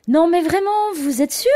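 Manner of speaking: high, loud, doubtful nurse; insisting nurse. Retold or imitated. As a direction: press her, are you really sure. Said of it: "insiste-t-elle".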